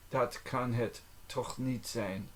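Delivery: measured language-lesson enunciation. Shown as a demonstration. Phrase repeated slowly for learning.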